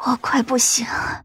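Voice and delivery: breath-only plea, barely voiced; weak, dying whisper. A Yin in pain pleads with the yaksha, weak whisper. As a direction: whisper it as if strength is running out. Injured friend begs for rescue.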